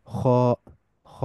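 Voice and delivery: খ with rounded mouth and heavy vowel; heavy Arabic "kh" on খ; tafkhim খ. Shown as a demonstration. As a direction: say খ full, between "khaa" and "kho". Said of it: "এটাকে আরবিতে বলা হয় তাফখিম".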